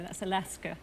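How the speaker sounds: ordinary delivery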